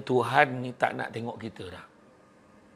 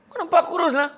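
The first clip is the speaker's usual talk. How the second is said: much higher playful voice; high teasing compliment; 14 semitones higher, 4 dB louder than usual. Acted. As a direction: sell the lie brightly. Fifth friend compliment.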